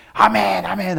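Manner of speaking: loud, high shouted amen; enthusiastic amen. Shown as a demonstration. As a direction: call out amen like a lively congregation. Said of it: "난리가 아니어야 돼".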